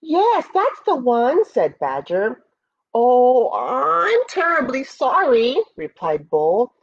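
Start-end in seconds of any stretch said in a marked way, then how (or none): 0.0-1.4 high-pitched little badger; small animal voice, pitched well up; badger character. 2.9-5.6 apologetic bull. Acted. Bull makes excuses to badger.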